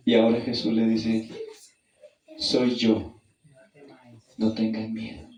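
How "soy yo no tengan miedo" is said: gentle Jesus. Acted. Jesus calms their fear.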